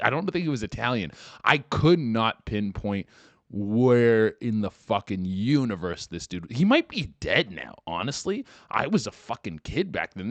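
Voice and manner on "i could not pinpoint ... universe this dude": ordinary talk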